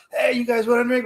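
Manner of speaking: high, bright invitation to drink; partygoer offer. Retold or imitated. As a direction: call out like a party host.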